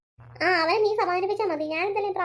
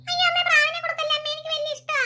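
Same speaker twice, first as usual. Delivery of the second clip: put-on high, whiny child's plea; pleading little boy; 9 semitones higher than usual. Young son begging mother not to give away the pigeons, high pleading child voice. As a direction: beg like a small boy about to lose his pet.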